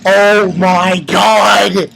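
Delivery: very slow, drawn-out disbelief; stunned reaction. Retold or imitated. Reviewer reacting to the blow on Genma, slow stunned drawl. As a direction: stretch each word in amazement.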